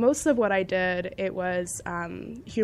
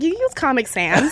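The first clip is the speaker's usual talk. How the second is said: mocking retort; 6 semitones higher, 7 dB louder than usual. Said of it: "someone's like".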